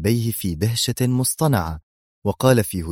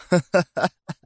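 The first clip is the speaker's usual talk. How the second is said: high scornful acted laugh; mocking laugh; 8 semitones higher than usual. A narrator's reading. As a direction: answer a threat with derisive laughter. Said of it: "على شكل ضحكة ساخرة عالية". (ضحكة تهكم عالية الطبقة)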